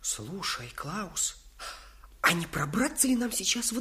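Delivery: whispered call to a friend; hush, the guard sleeps. Acted. Plotting near sleeping guard. (шёпотом)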